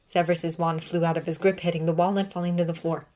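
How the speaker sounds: ordinary delivery, nothing marked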